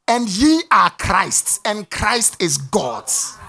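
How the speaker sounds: slow, deliberate, word by word; slow reverent quote. Acted. Pastor quoting the verse he loves, slow. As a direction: let each word land slowly.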